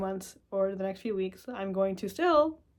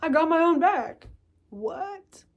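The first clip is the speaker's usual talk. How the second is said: mock surprise; 8 semitones higher, 5 dB louder, slower than usual. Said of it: "Then it's like".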